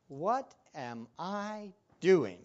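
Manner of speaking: slow, word-by-word question to himself; retold self-questioning. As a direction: draw out each word in mock despair.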